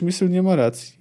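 usual speaking voice

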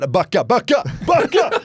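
high loud shout, laughing at the end; nervous shout. Retold or imitated. Zlatan pushing back crowding kids, high mock shout. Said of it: "han blir lite nojig".

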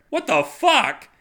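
very high, slow, loud outburst; horrified shock. Acted. Alien reacting to hearing of cremation, shocked shriek. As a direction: recoil in disgust.

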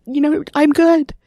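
speaking through a real lump in the throat; choked up. Real, not acted. Sharing a painful memory of her mother, choked up. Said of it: "I'm getting a little choked up on that".

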